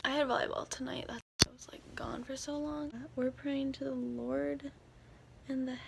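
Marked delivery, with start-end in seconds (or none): 0.0-2.9 hushed late-night whisper. Real, not acted. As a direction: whisper so no one wakes.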